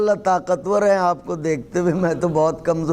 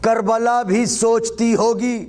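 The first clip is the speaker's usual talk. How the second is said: high, declaimed verse with deliberate pauses; poetry recitation; 5 semitones higher than usual. Acted. Poet building a verse for a cheering gathering, raised declamation. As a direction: declaim each phrase, pitched well above chat.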